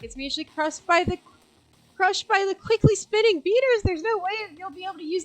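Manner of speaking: building to high loud; rising dramatic narration. A narrator's reading. Narrator builds as the hand gets crushed.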